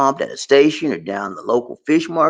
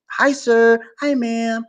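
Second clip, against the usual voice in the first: high, bright-voiced clerk greeting, much higher; imitating shop staff; 10 semitones higher, much slower than usual. Store clerk greeting a foreign customer, high and sunny. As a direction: chirp it like a cheerful store clerk. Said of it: "They'll say both".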